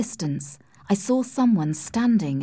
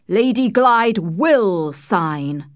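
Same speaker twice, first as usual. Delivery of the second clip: slow, insistent, raised voice; angry shout; 5 dB louder, much slower than usual. A narrator's reading. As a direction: thunder it out slowly, leaning on each word. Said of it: "shouted Sir Percival".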